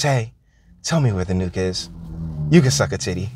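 low seductive woman. Retold or imitated. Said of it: "she says".

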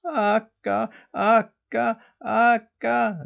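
high, loud shrieking gosling voice; heartrending gosling shriek. A narrator's reading. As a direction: shriek the name in distress. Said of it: "they shrieked in heartrending tones".

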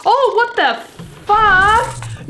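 scared horror game streamer. Real, not acted. Startled by game monster.

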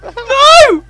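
high sing-song tease; playful taunt. Acted. Teasing his friend in a squeaky voice.

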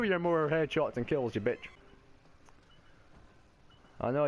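ordinary delivery

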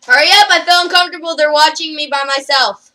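loud, high, rushed complaint; urgent yelling. Real, not acted. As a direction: yell it fast in a fluster. Panicking at being watched.

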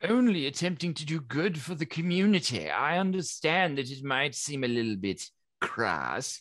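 lightly acted plea from a misunderstood scientist; earnest alchemical researcher, pleading. Researcher begs objectors not to destroy the work, lightly pleading. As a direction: plead your good intentions.

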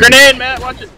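high urgent grenade callout; shouted warning. Real, not acted. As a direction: yell the warning at Matt.

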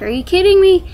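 high, loud retold disbelief; incredulous. Patient told to just hang in there, incredulous. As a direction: throw it back as an outraged question.

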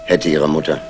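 normal talking voice

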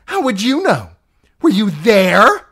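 a sister reliving her jealousy; obsessed, raving aunt. Acted. Lysa's jealous memory rant.

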